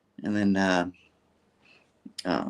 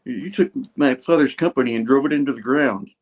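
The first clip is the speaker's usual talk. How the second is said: mock argument; 6 semitones higher, 4 dB louder than usual.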